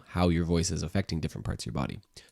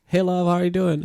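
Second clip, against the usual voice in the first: playful greeting; 12 semitones higher, 7 dB louder than usual. Pretend. Voicing love answering a call.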